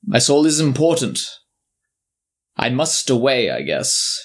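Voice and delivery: ordinary delivery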